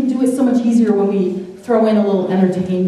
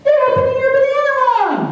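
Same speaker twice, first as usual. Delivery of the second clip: put-on much higher, a kid's taunting voice; mocking schoolboy. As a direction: taunt him like a cheeky young boy. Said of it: "comes up to him and says".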